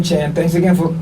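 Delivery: ordinary talk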